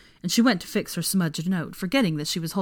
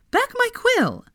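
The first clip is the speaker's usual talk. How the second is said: high, clipped-off protest; much higher young woman's protest; protesting Hermione; 4 dB louder, slower than usual. A narrator's reading. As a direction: push back, a little indignant.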